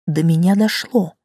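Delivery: plain reading voice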